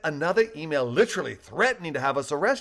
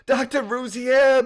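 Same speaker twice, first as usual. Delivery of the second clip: high, loud and slow with delight; overjoyed reunion call; enthused; 11 semitones higher, 8 dB louder, slower than usual. A narrator's reading. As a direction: greet him like a long-lost friend. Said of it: "balloon enthused".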